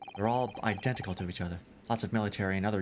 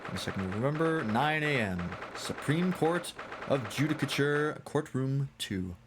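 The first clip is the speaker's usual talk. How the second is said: slow, deliberate dateline; announced scene caption; 5 semitones higher, slower than usual. Acted. Game caption introducing the court, slow announcement. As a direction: announce place and time, stretching each phrase.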